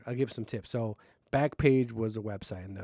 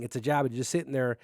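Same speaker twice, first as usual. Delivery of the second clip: ordinary talk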